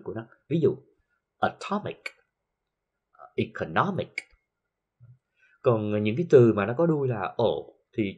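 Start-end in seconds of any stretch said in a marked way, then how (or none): 1.4-2.0 "atomic" with a high punch on TOM; stress on TOM. Shown as a demonstration. Teacher giving an -ic example, stressing TOM in "atomic". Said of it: "nhấn âm ở ngay phía trước". 3.4-4.1 "economic" stressed on the third syllable, NOM. Shown as a demonstration. Teacher illustrating the -ic rule, NOM stressed in "economic". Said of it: "nhấn âm ở ngay phía trước".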